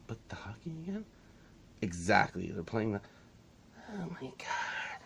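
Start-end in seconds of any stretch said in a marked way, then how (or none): 0.1-1.0 hushed whisper to camera; hushed aside. Real, not acted. 3.9-5.0 slow, exasperated whisper; tired hushed groan. Real, not acted.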